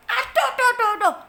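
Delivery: high, loud cries of aduh; pained yelps. Acted. Ant yelping as it falls in. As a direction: yelp in sharp pain.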